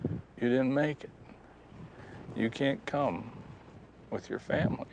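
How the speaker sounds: softly imagined rejection, with pauses; quiet, halting imagined verdict; dreaded verdict. Retold or imitated. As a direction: say it hushed, as words you dread hearing. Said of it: "I hope he doesn't say".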